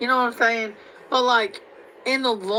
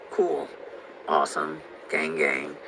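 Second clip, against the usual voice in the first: sarcastic; 4 dB quieter than usual. Acted. Sarcastic mock praise.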